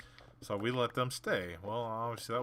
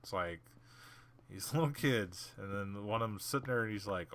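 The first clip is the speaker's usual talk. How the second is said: ordinary talk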